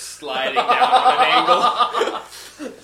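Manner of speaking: loud and slow, laughing through the words; real laughter over a silly punchline. Real, not acted. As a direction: crack up while describing the collapsing house. Laughing at his own absurd scenario.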